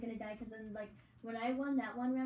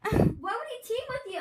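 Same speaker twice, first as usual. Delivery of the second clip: shrieking, loud, much higher disbelief; surprised; 12 semitones higher, 12 dB louder than usual. Real, not acted. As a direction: blurt it out in shock. Shocked at game chat.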